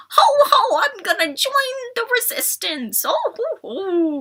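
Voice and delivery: high, drawn-out starry-eyed gushing; high-pitched cooing mockery of a wide-eyed kid; mocking kid impression. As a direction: sing out a mock hero-kid's big moment. Mocking the kid joining Resistance.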